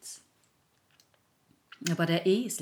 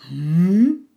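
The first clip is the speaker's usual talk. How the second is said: puzzled hm; 3 semitones higher, 16 dB louder than usual. Acted. Donkey puzzled.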